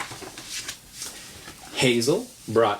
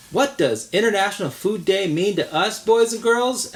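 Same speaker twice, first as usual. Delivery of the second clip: higher, louder sing-song teacher asking class; 8 semitones higher, 4 dB louder than usual. Acted. Missus Jenkins asking her class, bright teacher voice. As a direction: ask it like a kindergarten teacher.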